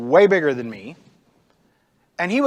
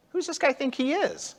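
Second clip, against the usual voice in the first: high, softer scoff; mocking; 8 semitones higher, 5 dB quieter than usual. David to the frightened Israelites about the giant, lightly mocking. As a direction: toss it off as an incredulous dismissal. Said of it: "kinda mocks him".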